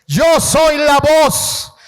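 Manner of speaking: slow, loud ringing declaration; proclaiming. Shown as a demonstration. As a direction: proclaim it like a cry from within. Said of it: "El grito que debe haber".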